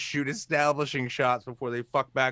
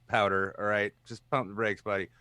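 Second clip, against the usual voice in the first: usual speaking voice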